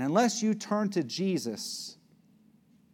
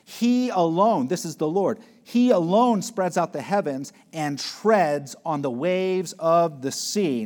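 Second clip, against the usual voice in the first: "He alone" slow and much louder, each word weighted; slow emphatic reading; 3 semitones higher, 7 dB louder than usual. Acted. Preacher lingers on verse eight for the congregation, slow and loud. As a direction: lean hard on every word.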